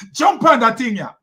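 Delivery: loud, high Jamaican through laughter; Jamaican accent. Acted. Host voicing a Jamaican urging a jump, laughing Jamaican accent. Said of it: "Jamaican be like".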